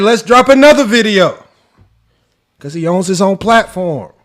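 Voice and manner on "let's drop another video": imitating Gil, defiant. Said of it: "He said".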